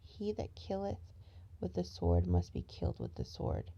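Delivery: loud insistent preaching repeat; booming sermon reading; scripture reading. Acted. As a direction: proclaim each listen like a preacher.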